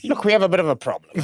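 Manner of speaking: high, fast put-on teacher voice, breaking bad news; quick, prim teacher voice, going up high; mock stern teacher. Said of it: "the teacher sat my mom down and she was like".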